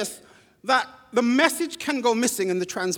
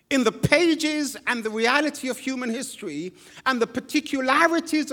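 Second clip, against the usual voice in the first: raised, ringing preaching voice; 4 semitones higher than usual. Acted. Preacher exhorting the congregation, raised and ringing. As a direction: preach it out to the hall.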